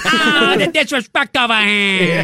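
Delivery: put-on big, loud Italian-American mobster accent; mock-outraged wiseguy bellow with an Italian-American accent; Tony Soprano. Redoing his practised line for the co-hosts, mobster accent. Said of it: "doing the Tony Soprano voice".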